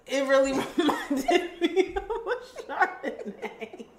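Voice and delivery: slow, laughing through the words; laughing tasting note. Real, not acted. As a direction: chuckle as you say it. Laughs describing the wine.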